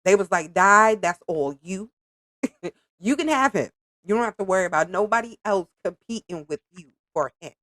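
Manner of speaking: ordinary talk, nothing marked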